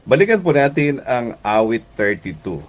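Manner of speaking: ordinary delivery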